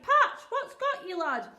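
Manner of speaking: high, loud panicked call; frightened cry. Retold or imitated. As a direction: cry out fearing the worst. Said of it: "I cried".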